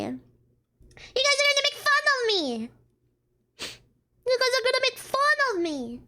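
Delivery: squealed, self-conscious complaint; whiny protest. Pretend. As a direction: wail in playful embarrassment, falling at the end. Embarrassed protest to the audience.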